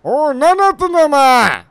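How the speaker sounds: loud, high-pitched telling-off; angry shout. Pretend. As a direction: yell at them, demanding an explanation. 相手を怒鳴って問い詰める. (怒鳴って問い詰める)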